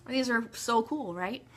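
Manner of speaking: normal talking voice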